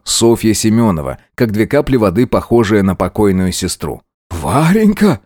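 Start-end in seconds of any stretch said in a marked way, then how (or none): 4.3-5.2 gasping in terror, high; gasping for air. A narrator's reading. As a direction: cry out the name, breathless with fear. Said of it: "дико вращая глазами, задыхаясь". (задыхаясь от ужаса)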